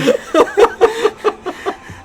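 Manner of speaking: high laugh burst. Real, not acted. Laughing at own bathroom gaming.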